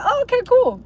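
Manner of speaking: high, flat-out uninterested oh okay cool; mock polite indifference; bored brush-off. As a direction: dismiss it with a high fake interest. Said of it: "I'm just gonna be like".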